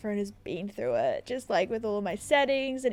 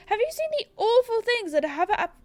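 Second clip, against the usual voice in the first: higher-pitched, fretful official; councilman voice; 9 semitones higher, 3 dB louder than usual. Acted. Councilman greeting Cassandra about awful happenings, high and fretful. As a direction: play a worried village councilman. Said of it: "what voice do I do for the councilman".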